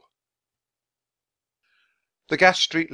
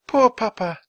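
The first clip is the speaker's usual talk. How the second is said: high, loud lament; mournful; 14 semitones higher, 8 dB louder than usual. A narrator's reading. Young woman to fellow mourners, mournful. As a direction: grieve aloud for a father. Weeping daughter mourns at graveside.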